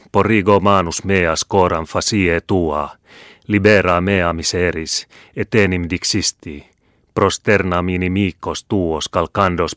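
slow, intoned prayer; Latin prayer. Acted. Old scholar praying to the Lord, slow and solemn. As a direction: plead slowly to God in solemn Latin.

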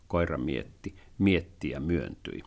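ordinary delivery

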